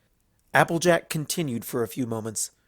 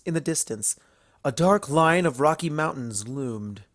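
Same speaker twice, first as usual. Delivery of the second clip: plain reading voice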